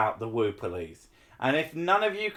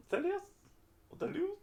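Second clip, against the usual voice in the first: "Ortelius" called very high and rising; high questioning call; 9 dB quieter, much faster than usual. Real, not acted. Calling the name again.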